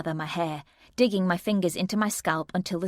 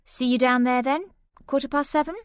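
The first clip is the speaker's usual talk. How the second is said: higher, breezy sign-off; brisk friend; 7 semitones higher than usual. Acted. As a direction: wrap up quickly. Friend wraps up call.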